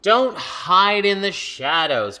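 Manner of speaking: loud, slow, bright read-aloud; reading to children. Pretend. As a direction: savor each word for the kids.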